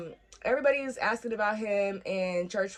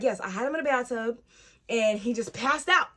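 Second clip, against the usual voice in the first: imitated panic; 4 semitones higher, 4 dB louder than usual.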